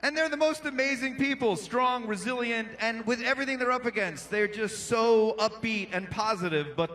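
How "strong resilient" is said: ordinary talk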